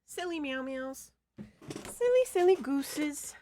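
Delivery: high, slow pet cooing; baby talk. Acted. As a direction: coo at the silly cats. Cooing at the cats.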